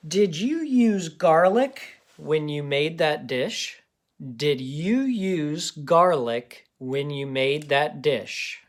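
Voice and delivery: slow, clear dictation voice. Shown as a demonstration. Teacher dictating a sentence slowly to kids. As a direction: read it slowly for children to write. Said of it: "Let's do the sentence".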